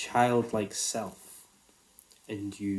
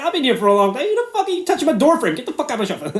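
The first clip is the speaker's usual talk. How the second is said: higher and louder, hammed-up indignation; mock territorial rant; 13 semitones higher, 11 dB louder, faster than usual. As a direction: play up the outrage, half laughing. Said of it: "I'm looking at him like".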